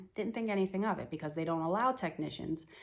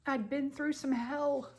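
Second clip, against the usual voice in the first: higher, voice strained with tears; tearful; 6 semitones higher than usual. Real, not acted. Mother recalling praying for a miracle, tearful and higher. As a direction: push through the tears, trembling.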